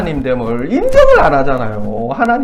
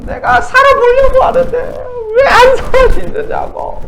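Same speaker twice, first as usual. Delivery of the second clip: put-on high, slow, teary drunken whine; drunk crying complaint; 3 dB louder, slower than usual. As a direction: sob it like a drunk caller. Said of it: "술 먹고 막 전화해". (술 취해 우는 듯한 하소연)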